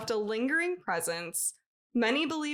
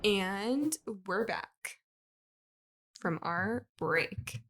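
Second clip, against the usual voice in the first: slow; much slower than usual. Pretend. Announcing return from break.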